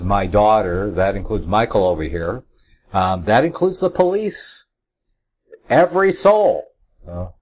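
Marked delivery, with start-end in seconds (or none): 5.7-6.7 high, loud, emphatic "every soul"; stress on "every". Shown as a demonstration. Summing up who is under authority, stress on "every". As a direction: drive "every soul" home, pitched high then falling. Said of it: "I'm emphasizing the word every".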